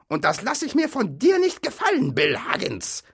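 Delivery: high, loud troll outburst; furious troll yell. A narrator's reading. Bert yelling at Bill before punching him, furious. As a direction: screech it before throwing a punch. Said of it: "schrie Bert".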